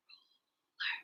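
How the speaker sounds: "Ruler" whispered very softly in two parts; whispered syllables. Shown as a demonstration. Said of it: "Whisper with me".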